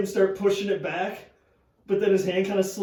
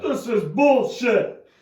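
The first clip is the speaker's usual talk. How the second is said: angry; 5 semitones higher, 6 dB louder, slower than usual. Acted. Sore loser complaint.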